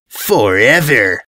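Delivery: slow, lightly voiced delight; gleeful invader. Invader promising the ponies, slow and gleeful. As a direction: savour the word like a prize. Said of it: "with open delight".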